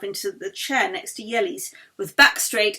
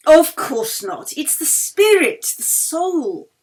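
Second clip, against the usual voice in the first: slow, louder and higher, fervent; earnest agreement; 6 semitones higher, 8 dB louder, slower than usual. A narrator's reading. Yeli fervently agrees with the Baroness about friendship. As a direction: lean in and mean it.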